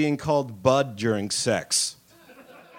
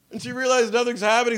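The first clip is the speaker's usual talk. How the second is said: usual speaking voice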